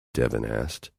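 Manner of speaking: plain reading voice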